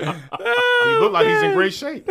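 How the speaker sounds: laughing fit. Real, not acted. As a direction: lose it laughing as you say it. Cracking up at the signing joke.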